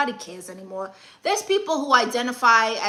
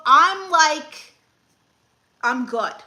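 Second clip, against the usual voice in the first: normal talking voice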